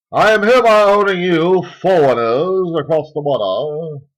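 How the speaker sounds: grand, high-pitched decree; pompous proclamation. Acted. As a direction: proclaim it like a tyrant. Mock proclamation.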